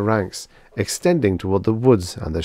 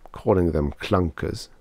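ordinary delivery

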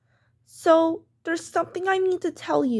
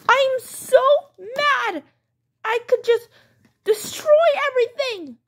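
put-on furious child; 6 semitones higher than usual. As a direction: rage like a kid in a fit. Child's tantrum.

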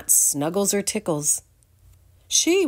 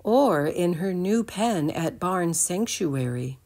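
ordinary delivery, nothing marked

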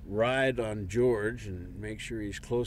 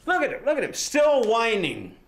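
angry shout; 13 semitones higher, 8 dB louder than usual. Acted. Father complaining about his whining son, angry shout. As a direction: yell in exasperation at a whiner.

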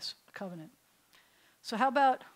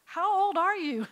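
put-on teen Avram; 9 semitones higher, 6 dB louder than usual. Teen Avram voiced in story.

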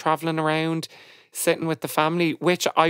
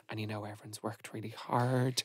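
soft consoling; 5 semitones lower, 14 dB quieter than usual. Retold or imitated. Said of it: "trying to do the thing of".

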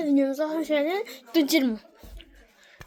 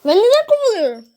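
indignant protest; 5 semitones higher, 7 dB louder than usual. Acted. Protesting being fired.

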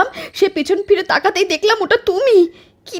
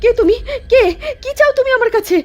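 tearful, frightened pleading, higher; terrified; 5 semitones higher than usual. Acted. Wife confronts the ghost in her husband's form, crying with fear. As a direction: voice breaking with fear.

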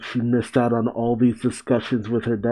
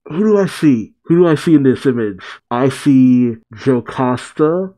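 ordinary delivery

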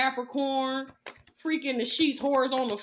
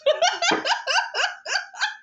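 a sudden, much higher burst of laughter; high mocking laugh; 5 dB louder than usual. Real, not acted. Laughing at the one who got fake evidence, high burst. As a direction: cackle at the rival's expense.

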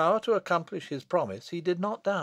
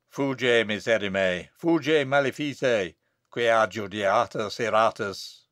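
loud, slow Latin intoning; solemn Latin banishment; 5 semitones lower, 5 dB louder, slower than usual. Acted. Abbot bids the wretch flee, solemn Latin. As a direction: declaim the Latin like an exorcism.

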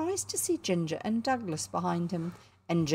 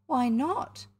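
high rising challenge; indignant; 6 semitones higher than usual. A narrator's reading. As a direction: protest like an offended boy, pitched up. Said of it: "said William indignantly".

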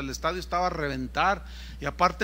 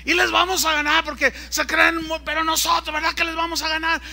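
high, rousing hype-man voice; boastful rally shout; 9 semitones higher, 5 dB louder than usual. Retold or imitated. Organizer firing up fans against Argentina, boastful shouting. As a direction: work the crowd, pressing them to agree.

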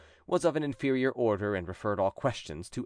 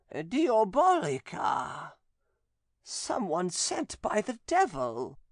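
much higher, slow, eerie possessed voice; possessed nun; 10 semitones higher, much slower than usual. A narrator's reading. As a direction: speak as the demon inside her, slowly. Possessed nun answers exorcist.

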